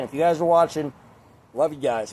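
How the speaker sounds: ordinary talk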